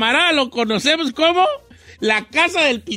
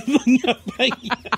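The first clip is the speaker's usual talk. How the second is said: laughing; slower than usual. Real, not acted. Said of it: "Qué gacho".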